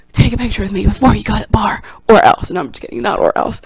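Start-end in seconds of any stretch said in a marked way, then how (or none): 0.1-1.8 1 mock whisper. Imagined ambush of Al Pacino. 2.1-2.5 1 mock threat. Mock threat, then "just kidding.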